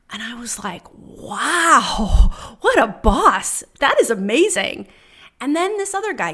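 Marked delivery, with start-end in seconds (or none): 0.9-4.9 loud, drawn-out amazement; big wowed reaction, retold; awed excitement. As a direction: gush with admiration, swooping and emphatic. Her awed reaction to the sign-off.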